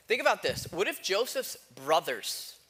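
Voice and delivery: ordinary delivery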